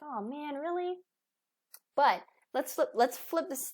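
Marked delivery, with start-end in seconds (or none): 0.0-1.0 put-on disappointed kids. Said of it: "They're like".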